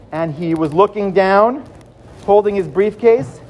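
ordinary delivery

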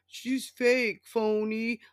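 high, loud mock Trump whine. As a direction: sneer it out like a sulky Trump. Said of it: "trying to come up with stuff to, like, say".

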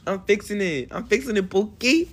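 high playful sass; sassy teasing. Acted. Teasing his friend, he answers high and sassy. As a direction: toss it off sassy to your pookie.

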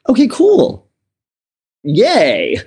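high, loud mock cheer; playful, exaggerated little celebration; elated relief. Relieved reply to mother, retold.

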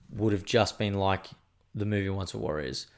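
ordinary talk

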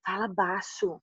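softly, like a father hushing his daughter in public; a quiet urgent hush, Fala baixo; hushed plea. Retold or imitated. Father asks her to lower voice.

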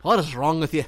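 mock-scolding in an Irish accent. Ribbing a friend after his race remark, Irish voice. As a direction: exclaim it with a put-on Irish lilt. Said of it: "We just went to a little Irish voice".

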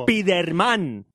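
SPIDERMAN very slow, Spanish "es-pee-der-MAN"; stress on final syllable. Shown as a demonstration. Host saying it with gusto, Spiderman stressed on MAN. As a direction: stretch "Spiderman" out, landing on MAN. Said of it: "le pongo el acento en man".

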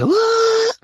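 very high, squealed "What?"; high crying-edged disbelief; mock shock. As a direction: shriek it in fake shock. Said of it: "I was like".

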